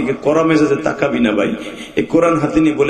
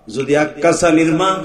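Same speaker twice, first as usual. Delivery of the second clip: ordinary talk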